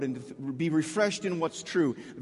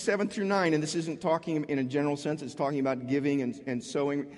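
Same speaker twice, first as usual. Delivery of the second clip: normal talking voice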